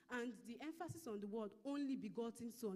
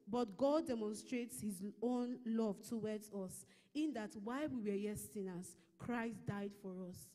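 slow, louder reading of the verse; scripture reading; 5 dB louder than usual. Real, not acted. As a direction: read the verse out slowly and deliberately.

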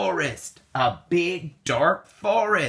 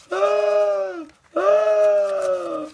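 high, loud frightened shout; scared cry; 6 semitones higher, 4 dB louder than usual. Acted. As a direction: cry out as the bear appears. Bear reaches the front door.

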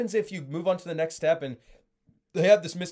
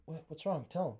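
wife asking quietly; 8 dB quieter than usual. Retold or imitated. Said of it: "Then Mary looks at John".

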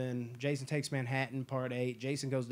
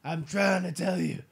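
louder strained put-on plea; mock Seagal insisting; 3 semitones higher, 6 dB louder than usual. Seagal insisting to the staff, strained loud mock plea. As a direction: insist you're about to die. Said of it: "He's just like".

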